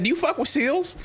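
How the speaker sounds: high-pitched imitation of her question; put-on voice for her. Streamer mimicking the raider asking about seals, high put-on voice. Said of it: "I'm sorry I gave you that voice".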